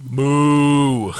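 cow moo. Pretend. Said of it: "an impression of a French cow".

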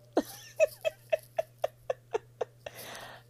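loud high laugh, a bit teary; high embarrassed laugh. Real, not acted. Thanking her uncles on air, laughing off tears. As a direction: laugh it off through emotion.

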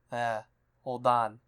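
low, soft mumbling godfather; Marlon Brando voice. Acted. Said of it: "I'm gonna do the voice".

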